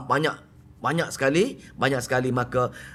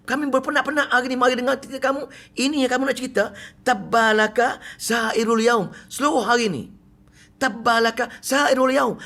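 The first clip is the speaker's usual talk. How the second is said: higher, faster indignant rebuke, stretched on the curse; mock angry Abu Lahab; 9 semitones higher than usual. Abu Lahab scolding the Prophet before the gathered clan, higher mock anger. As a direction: scold the whole day as wasted, hammed up. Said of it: "dia kata apa".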